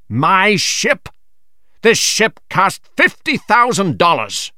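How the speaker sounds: captain insisting his ship is worth a fortune; indignant captain. Acted. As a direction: lean on the price, offended. Captain protests the ship's price.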